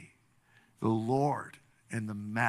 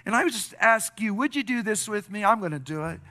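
raised-pitch, pleading prayer leading; fervent prayer; 6 semitones higher, 5 dB louder than usual. Real, not acted. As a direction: lead the congregation phrase by phrase. Leads congregation in prayer.

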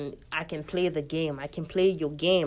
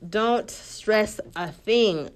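slow, drawn-out reassurance; reassuring; 3 semitones higher, 4 dB louder, much slower than usual. Real, not acted. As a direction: slowly soothe your diaspora fam. Reassuring diaspora listeners directly.

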